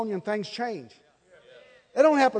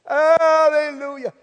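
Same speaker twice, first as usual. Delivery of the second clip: laughing hallelujah; 7 semitones higher, 4 dB louder, slower than usual. Real, not acted. Laughing exclamation.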